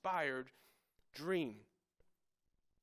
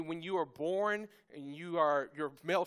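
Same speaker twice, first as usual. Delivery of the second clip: ordinary talk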